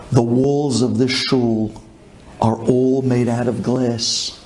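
ordinary talk

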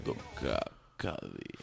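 deep sexy voice. Pretend. Asked for something unsexy, a deep sultry voice. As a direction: drag the word out low and sultry. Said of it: "Πες μου, [name], κάτι που ... δεν είναι καθόλου σεξι".

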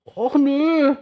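high, loud sulky toothbrush whine. A narrator's reading. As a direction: moan it like a disappointed little brush. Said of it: "mault die Zahnbürste".

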